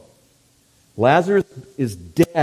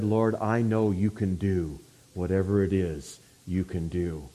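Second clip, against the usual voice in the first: soft, lower, gentle trust; hushed, tender assurance; 6 semitones lower, 8 dB quieter than usual. Retold or imitated. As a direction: lower and soften into quiet faith. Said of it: "so gently, she said".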